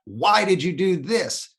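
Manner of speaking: venting at God, voice climbing; angry cry to God. Retold or imitated. Man yelling at God after his knee injury, furious and pleading. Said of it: "I yelled out to God".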